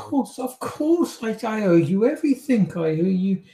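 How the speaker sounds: high-pitched, tumbling agreement; desperate eagerness. Acted. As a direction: jump at the bargain in a rush. Captive desperately accepts the bargain.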